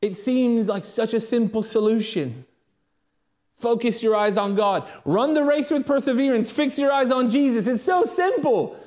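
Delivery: high, loud, driving sermon voice; fiery preaching. Acted. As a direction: preach it hard to the congregation. Preaching builds to full force.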